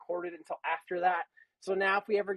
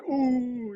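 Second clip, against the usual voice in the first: drawn-out taunting schoolkid "ooh"; middle-school "ooh"; 4 semitones higher, 5 dB louder than usual. Retold or imitated. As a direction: mimic classmates when someone's in trouble. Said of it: "like you're in middle school".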